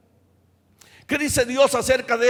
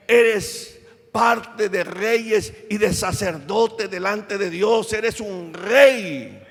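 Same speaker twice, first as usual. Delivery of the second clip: slow, solemn declarations; slow affirmations; 3 semitones lower, slower than usual. Acted. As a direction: let each truth land slowly. Afirmaciones lentas y solemnes. (afirmaciones lentas y solemnes)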